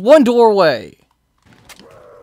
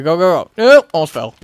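usual speaking voice

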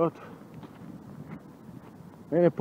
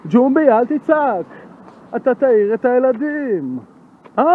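high, mock-scolding shout; yelled scolding; 10 semitones higher, 11 dB louder than usual. Scolding Jumbi for waking the children, while shouting. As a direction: yell the reprimand far louder than needed. Yelling a telling-off not to yell.

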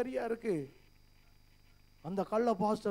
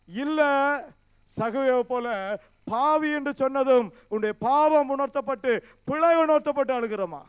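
loud, raised preaching voice, pressing the questions; forceful preaching; 5 semitones higher, 9 dB louder than usual. Acted. Pastor challenging the brothers in church, loud forceful preaching. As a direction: hammer each question at the congregation.

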